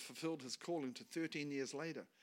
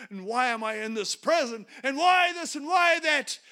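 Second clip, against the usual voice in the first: anguished outcry; 11 semitones higher, 15 dB louder than usual. Retold or imitated. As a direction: cry out to God in despair. Joseph crying out in prison.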